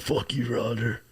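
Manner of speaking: hushed, understated put-down; how it was meant. Shown as a demonstration. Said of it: "but I meant to say, like".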